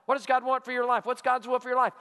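ordinary delivery

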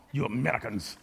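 low, muttered mock French waiter; impatient waiter. Waiter scoffing at the American who can't order, low mock-French mutter. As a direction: scoff at the fumbling tourist.

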